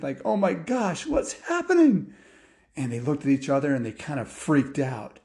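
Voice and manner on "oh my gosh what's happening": acted panic, pitch shooting up; high-pitched storybook alarm; panicked. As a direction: play boys freaking out. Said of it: "like, were panicked".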